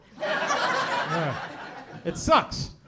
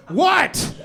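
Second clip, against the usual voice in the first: annoyed dispatcher; 7 semitones higher, 5 dB louder than usual. Retold or imitated. Annoyed 911 operator.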